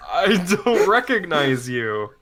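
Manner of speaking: high, slow, wary reply; puzzled vendor. Acted. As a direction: draw it out to a stranger you don't know. Game master voices puzzled vendor.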